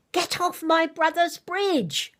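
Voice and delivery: high, slow mock toddler shout; child's shout. Mother imitating her three-year-old yelling at the goat. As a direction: shout it as an outraged little boy. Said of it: "my three-year-old shouted out".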